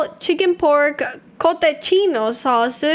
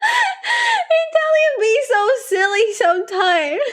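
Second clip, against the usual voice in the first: laughing high; 9 semitones higher than usual. Real, not acted. Laughing at dish name.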